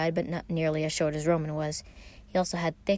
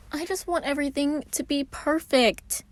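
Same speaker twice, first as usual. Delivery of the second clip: high anxious whine; fussy Roman; 10 semitones higher than usual. A narrator's reading. Roman fussing to Logan, high and fretful. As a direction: fret over every detail.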